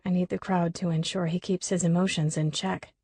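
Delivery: usual speaking voice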